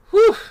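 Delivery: a loud falling whew of release; descending exhale. Shown as a demonstration. Teacher shows the falling breath at the line end. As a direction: let out a sighing whew. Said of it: "this descending huh that happens".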